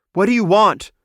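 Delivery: high and loud, standing her ground; challenging demand. A narrator's reading. Healer shielding patients from rats, sharp demand. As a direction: confront the intruders. Nurse confronting intruders.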